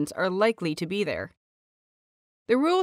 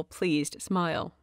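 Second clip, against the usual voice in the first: usual speaking voice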